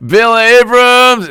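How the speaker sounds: high, loud, slow drawn-out name; hammed-up scolding of a name; mock indignant call-out. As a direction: call him out in playful outrage. Mock outrage at a viewer.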